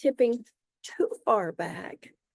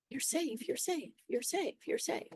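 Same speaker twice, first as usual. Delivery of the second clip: hushed, quick little repeated reassurances; gently murmured comfort, much softer; 4 semitones higher, 8 dB quieter than usual. Acted. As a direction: soothe a frightened body, very softly. Soothing words spoken to the body.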